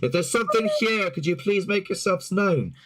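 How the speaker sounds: high, sustained calling to spirits; demonstrated ringing ghost-hunter summons; echo-making call-out. Retold or imitated. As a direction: call it out into an empty building, quickly. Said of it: "creating echoes in the air".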